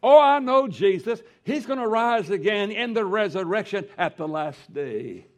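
high and loud, fervent conviction; Martha's eager faith. Retold or imitated. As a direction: declare her belief with fire. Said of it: "Martha said".

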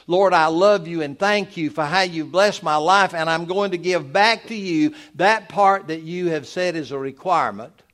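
loud declaration to the Lord; preached prayer. Acted. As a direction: voice a giver's thankful prayer with pulpit force. Voices a giver's prayer while preaching.

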